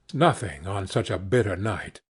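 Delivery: plain reading voice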